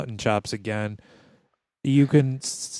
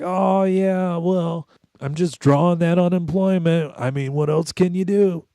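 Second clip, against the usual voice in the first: stoner dude voice; 7 semitones higher, 5 dB louder than usual. Retold or imitated. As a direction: shrug it off like a slacker kid. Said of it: "He's like".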